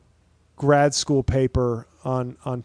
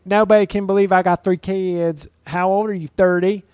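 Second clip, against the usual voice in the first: higher, louder bragging influencer voice; TikTok woman voice; 5 semitones higher, 5 dB louder than usual. Retold or imitated. Comedian mocks TikTok moms with a bragging higher voice. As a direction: preen like a mom fishing for compliments online. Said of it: "I'm gonna use my TikTok woman voice".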